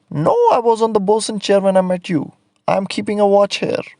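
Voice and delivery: the same put-on painter, eager to excuse himself; painter's voice. Man on radio replies.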